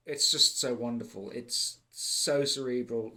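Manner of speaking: ordinary delivery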